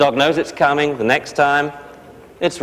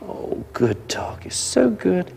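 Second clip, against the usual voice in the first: slow, soft whispered praise; 7 semitones higher, 6 dB quieter, slower than usual. Shown as a demonstration. Trainer whispers praise to the puppy, soft and slow. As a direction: breathe the praise gently.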